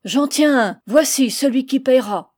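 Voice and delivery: put-on blindfolded old woman crowing in triumph; triumphant cry. A narrator's reading. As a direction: crow over the catch. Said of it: "un cri de triomphe".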